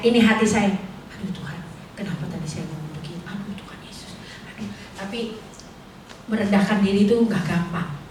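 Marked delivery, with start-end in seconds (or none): 1.1-5.4 very soft, quick murmured plea to God; almost under the breath, ashamed; sinking into a low muttered prayer; hushed remorseful prayer. Retold or imitated. Retold remorse, praying to herself.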